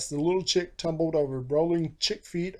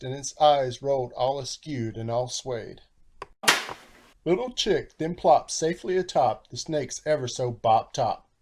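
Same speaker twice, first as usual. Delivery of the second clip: usual speaking voice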